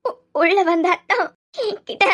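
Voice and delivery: tearful, broken disclosure; sobbing confession. Acted. Daughter struggling to say what happened, sobbing confession. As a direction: stammer and choke on the words. Sobbing girl accuses her brother-in-law.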